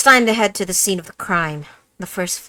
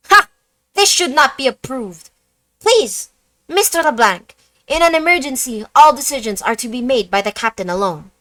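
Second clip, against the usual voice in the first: louder, firm officer voice; stern young officer; 5 semitones higher, 6 dB louder than usual. Acted. Officer tells the detective to wait, firm and bossy. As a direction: lay down the rules, bossy and brisk.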